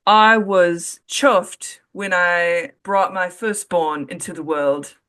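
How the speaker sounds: slow, put-on British accent; a proud parent in a hammed-up British accent. Shown as a demonstration. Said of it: "I'll say it in a British accent".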